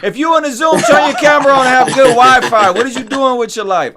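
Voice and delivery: high, loud exasperated rant; mock scolding rant. Real, not acted. Host scolding guest on Zoom call, loud high mock rant. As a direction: roast him for hiding his camera, loud and high.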